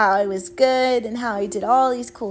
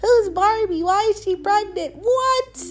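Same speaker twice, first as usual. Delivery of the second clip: high, excited doll-drama voice; doll play voice; 10 semitones higher, 3 dB louder than usual. Pretend. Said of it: "act out these crazy, wild scenarios and just be like".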